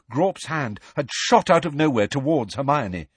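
plain reading voice

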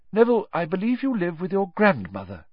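encouraging teacher, pitched well above narration; Professor Lupin teaching. Acted. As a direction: explain to the class with emphatic key words.

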